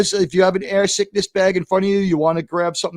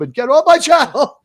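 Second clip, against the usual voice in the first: high, loud send-off; indignant shout, pitched up; indignant dismissal; 9 semitones higher, 7 dB louder than usual. Real, not acted. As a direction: raise the voice and order them out. Ordering offenders off the channel.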